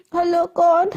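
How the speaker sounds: mildly acted weepy hello; tearful voice. Shreya answers an unknown call while crying, in a whiny tearful voice. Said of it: "ऐसे रोंदू आवाज़ में बोलती है".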